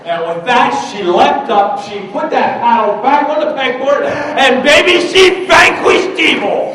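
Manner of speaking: very high, full-force preacher-like yell; triumphant shouted climax. A narrator's reading. Story's climax, teacher leaps to paddle.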